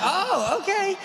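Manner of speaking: squeaky high, startled "oh, okay"; mock surprise. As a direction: imitate the bartender caught off guard, way up high. Bartender surprised he's pastor.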